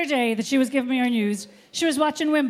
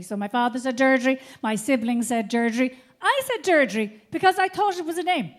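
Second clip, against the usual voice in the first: normal talking voice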